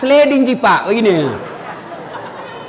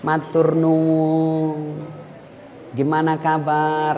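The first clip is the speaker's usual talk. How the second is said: soft, slow and humble; gentle polite speech; 5 semitones lower, 7 dB quieter, much slower than usual. Shown as a demonstration. Preacher showing refined speech to the congregation, soft and slow. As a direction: murmur it with refined Javanese courtesy. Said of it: "orang yang halus tutur katanya".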